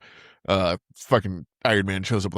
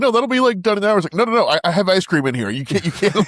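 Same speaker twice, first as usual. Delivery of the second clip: high, pleading mock voice; high-pitched whiny plea; squeaky mock panic; 11 semitones higher, 4 dB louder, faster than usual. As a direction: squeal it like a cornered kid. Squeaky imitation of trapped guy.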